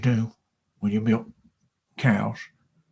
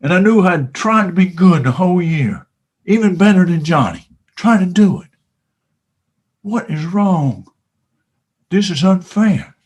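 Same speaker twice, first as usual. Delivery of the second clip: rising indignation; 4 semitones higher, 6 dB louder than usual. Retold or imitated. Child's outrage at Santa.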